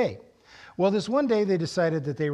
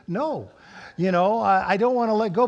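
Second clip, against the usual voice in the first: louder, higher, frightened protest; cling to the rock and refuse; panicked refusal; 4 semitones higher, 6 dB louder than usual. Retold or imitated. Refuses to let go.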